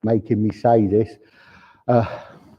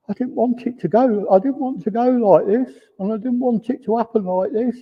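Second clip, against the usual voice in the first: higher, teary voice, mildly choked; a touch of tears in a higher voice; tearful; 9 semitones higher than usual. Retold or imitated. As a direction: voice the man's regret as if near tears. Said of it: "he's got tears in his eyes".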